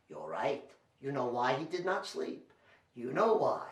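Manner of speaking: hushed confiding aside. Real, not acted. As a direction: lean in and murmur. Confiding to kids.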